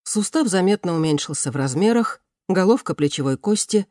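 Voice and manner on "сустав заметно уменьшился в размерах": ordinary delivery, nothing marked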